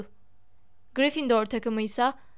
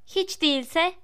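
higher, pointed delivery; firm, cutting reply at raised pitch; sharp retort; 4 semitones higher, slower than usual. A narrator's reading. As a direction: answer back sternly. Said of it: "Hermione sert sert".

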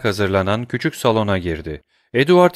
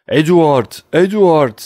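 loud high calls of the name; shouting; 7 semitones higher, 7 dB louder, slower than usual. A narrator's reading. Father yelling his son's name through the house, loud. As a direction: yell Edward down the hall. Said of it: "bağırdı".

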